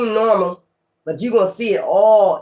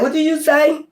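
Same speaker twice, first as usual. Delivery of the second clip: higher, sharp challenging question; indignant what did you say; 8 semitones higher than usual. Retold or imitated. Confronting someone who muttered at you, high indignant challenge. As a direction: demand it back like you half-heard an insult. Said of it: "You be like".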